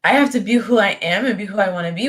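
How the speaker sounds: ordinary talk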